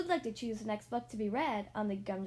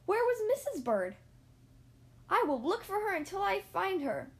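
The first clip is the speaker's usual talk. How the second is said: higher and louder, worried; anxious searching; 8 semitones higher, 5 dB louder than usual. Acted. Mister Bird searching for his lost wife, anxious and higher. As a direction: call out as if searching.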